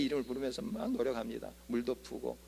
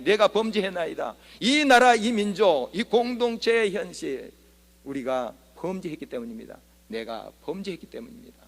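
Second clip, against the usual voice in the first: fervent confession; 3 semitones higher, 6 dB louder than usual. Acted. Acted repentance prayer in sermon.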